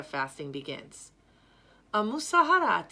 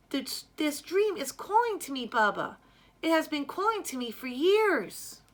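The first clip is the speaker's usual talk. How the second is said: put-on higher, louder pleading girl; eager young girl; 7 semitones higher, 4 dB louder than usual. Girl pleading with her Baba to be drummer, high and loud. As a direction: beg your father with all your heart.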